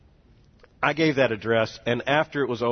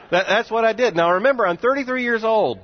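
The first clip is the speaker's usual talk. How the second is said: ordinary talk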